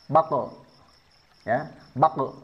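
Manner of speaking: "bako" with a full extra vowel after the q; salah, ditambah vokal. Shown as a demonstration. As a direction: add an "e" sound to the end of "bako". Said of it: "bukan sengaja ditambah". ("bako" dengan vokal e tambahan di akhir)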